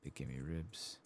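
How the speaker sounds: ordinary talk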